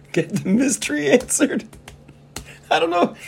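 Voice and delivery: very high, giggling squeak; laughing so hard the voice flips up; laughing falsetto. Real, not acted. Laughing at own joke.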